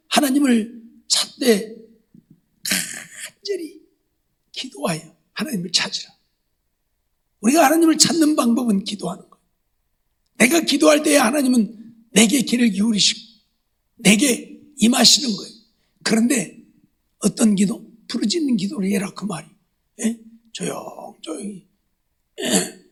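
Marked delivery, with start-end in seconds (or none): 2.6-3.8 간절히 stretched very long and high; earnest, long-held 간절히; drawn-out emphasis. Shown as a demonstration. Preacher stresses earnest seeking. 20.5-21.7 slow, near-whispered 조용조용히; mock-quiet murmur; hushed demonstration. Shown as a demonstration. Mocking quiet prayer against crying out.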